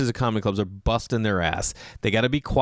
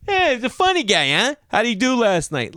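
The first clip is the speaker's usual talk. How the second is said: high-pitched club-member caricature; mock hearty holler; 12 semitones higher, 3 dB louder than usual. Club members greeting the visiting comedian, mock hearty holler. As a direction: hail him high, hamming up the nosy member.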